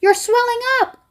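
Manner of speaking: very high alarmed cry; scream. A narrator's reading. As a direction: scream in horror. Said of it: "screamed Missus Beauregard".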